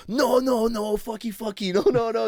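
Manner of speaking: put-on high, frantic accented driver voice; imitating the driver pitched high and panicky; mock panicked driver. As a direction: squeal it out like a flustered cabbie. Driver freaking out over kiss.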